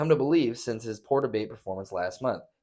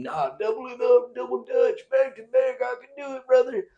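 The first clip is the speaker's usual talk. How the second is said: high, loud boastful bro voice; high swaggering mock boast; mock cocky Biden; 14 semitones higher, 5 dB louder than usual. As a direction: brag like a hyped-up old man. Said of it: "fuck you for then thinking".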